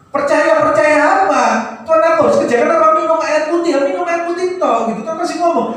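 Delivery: high-pitched, insistent preacher delivery; emphatic preaching. Acted. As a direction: press the rhetorical questions on the congregation, pitched high. (berkhotbah berapi-api dengan nada tinggi)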